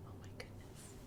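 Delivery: breathy awed whisper. Acted. Librarian marvelling at the vine for kids, whispered. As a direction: marvel under your breath.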